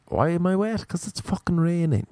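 high-pitched, incredulous comeback; exasperated retort. Retold or imitated. Snapping back at the girl who asks why he's wet, exasperated. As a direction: throw the question back as if it's obvious.

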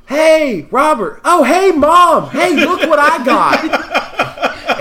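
high, loud gleeful holler; excited calling out. Retold or imitated. Friend shows off his find to pals outside the store, gleeful holler. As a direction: call out drawn-out, half laughing, to show off a find. Retold Eugene calling across parking lot.

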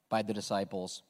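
ordinary talk, nothing marked

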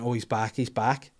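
usual speaking voice

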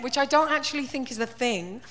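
ordinary delivery

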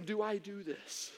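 slow, soft inner debate; hesitant, hushed self-questioning; thinking to oneself. Retold or imitated. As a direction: weigh it up quietly, word by word. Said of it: "I'm thinking".

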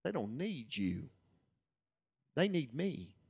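slow, soft and measured; hushed words pressed gently on the heart; Holy Spirit's quiet prompting. Retold or imitated. Holy Spirit answering a helpless preacher, softly and slowly. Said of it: "the Holy Spirit just impressed on my heart".

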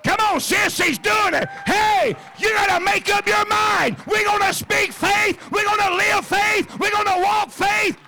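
high, loud, slow shouted preaching; preaching at full force. Acted. Pastor urging the church to speak faith, shouting. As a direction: drive every word home like a revival.

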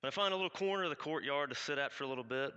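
ordinary talk

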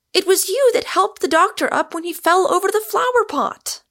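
very high, loud, frantic reproach; distraught woman at full pitch; distressed cry. A narrator's reading. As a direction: plead with him in high-pitched dismay. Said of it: "cried Rosamond, wringing her hands".

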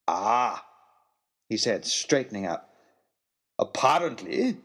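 lightly voiced old host, unhurried; a hint of a gentle, amused old man; mild old gentleman voice; calm old man. Nicholas speaks in story.

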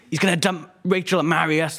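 high, teasing yell. Acted. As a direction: heckle it up high across the table. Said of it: "Gareth will yell".

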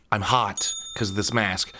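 ordinary delivery

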